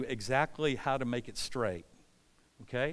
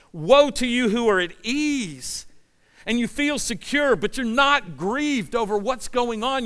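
loud, high fervent preaching; passionate preaching yell; 7 semitones higher, 7 dB louder than usual. Real, not acted. Pastor pleading with a complacent church, loud and high. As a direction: cry it out to the congregation with passion. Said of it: "I'm not just yelling at you".

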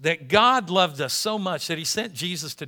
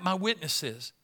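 ordinary talk, nothing marked

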